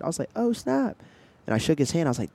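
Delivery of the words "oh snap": surprised. Retold or imitated. Excited recognition of neighbor.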